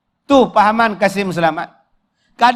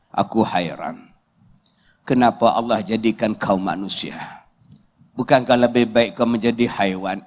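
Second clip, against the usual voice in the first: very low, hushed film-hero monologue; deep, quiet reproach with measured gravity; bitter blind Kasim; 11 semitones lower, 6 dB quieter than usual. Acted. Blind Kasim rebukes his mother-in-law, low and bitter.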